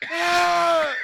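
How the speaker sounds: very high, loud shriek; loud high scream. Retold or imitated. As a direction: let out a sudden shriek. Retold murder scene, victim screams.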